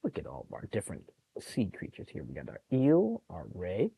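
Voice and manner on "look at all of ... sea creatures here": normal talking voice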